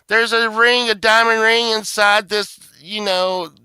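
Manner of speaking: loud, high, breathless gushing; dizzy wife. Retold or imitated. His wife Sunshine finding the ring, loud and high. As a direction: gush like a scatterbrained wife. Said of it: "Sunshine would be like".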